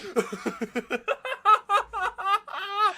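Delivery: high-pitched laughter; laughing hard. Real, not acted. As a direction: break into laughter. Reacting to the touchdown.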